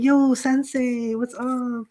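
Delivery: drawn-out, friendly 'yo' greeting; mock-exuberant American hello, slow and stretched. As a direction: imitate an exuberant visitor, stretching the words. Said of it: "like, exuberant".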